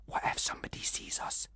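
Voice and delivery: hushed frightened whisper; scared boy whispering in the dark. Acted. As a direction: breathe it so nobody hears. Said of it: "whispered Callum".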